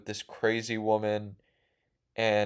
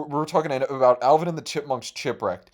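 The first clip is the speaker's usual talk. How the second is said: ordinary delivery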